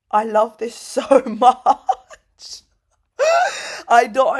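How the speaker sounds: real tears welling up while gushing; getting emotional. Real, not acted. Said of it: "I'm actually getting emotional".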